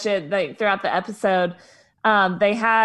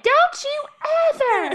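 very high-pitched mock tirade with a pause; mock going off; 3 dB louder than usual. Imitating a woman at the party fight, mock going off. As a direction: squeal it as an imitated outburst. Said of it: "Just going off".

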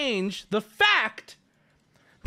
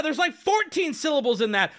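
ordinary delivery

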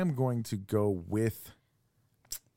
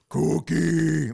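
Cookie Monster; 2 semitones higher, 6 dB louder than usual. Retold or imitated. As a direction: growl it like a furry monster. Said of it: "how hard is it to do Cookie Monster".

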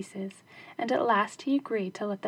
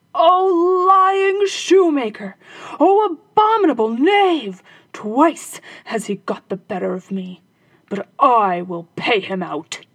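raging; 3 semitones higher, 10 dB louder, slower than usual. Acted. Robber captain cursing the shoemaker who fooled him, raging. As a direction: spit curses at the absent shoemaker. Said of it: "So he raged and swore".

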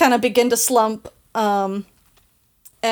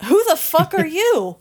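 higher, hammed-up demand; sharper repeat; 8 semitones higher than usual. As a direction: snap it out higher the second time. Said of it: "and then he says".

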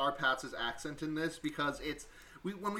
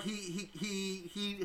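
normal talking voice

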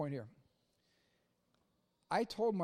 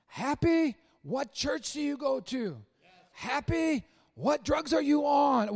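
higher, louder, building to a shout; admiring questions; 5 semitones higher, 5 dB louder, slower than usual. Retold or imitated. People amazed at his happiness, rising excited questions. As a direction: pepper him with amazed questions. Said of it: "I want people to go".

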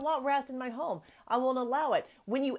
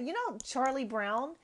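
ordinary delivery

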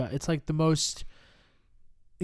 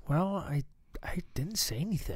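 hushed, hesitant whisper; mock whisper; 3 semitones higher, 7 dB quieter than usual. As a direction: play a sheepish applicant whispering back. Playing mute applicant, whispered answer.

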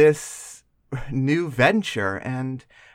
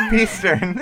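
laughing; 3 semitones higher than usual. Real, not acted. Repeating the slip laughing.

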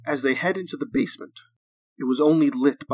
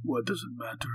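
put-on softer Russian-accented captive; 7 dB quieter than usual. A narrator's reading. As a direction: answer quietly with a thick Russian accent. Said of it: "his thick Russian accent coming through".

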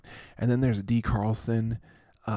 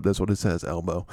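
usual speaking voice

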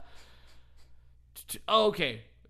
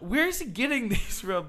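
amazed friend; 9 semitones higher, 7 dB louder than usual. Retold or imitated. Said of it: "she was just like".

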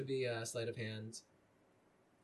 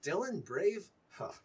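higher, mocking doubt; father scoffing at his son's bravery; scoffing disbelief; 6 semitones higher, slower than usual. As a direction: scoff at the idea.

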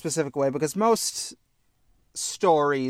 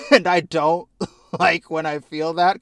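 laughing; 3 semitones higher, 5 dB louder than usual. Real, not acted. Laughing at own realization.

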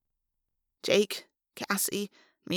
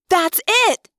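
much higher, loud eureka shout; excited yell; 11 semitones higher, 9 dB louder than usual. A narrator's reading. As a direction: burst out with the discovery. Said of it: "Cassie yelled".

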